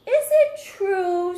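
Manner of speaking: loud, high, slow question; sly princess. Acted. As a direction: draw the dragon in. Elizabeth tricking the dragon.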